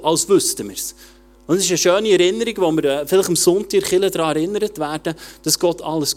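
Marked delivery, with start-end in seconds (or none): none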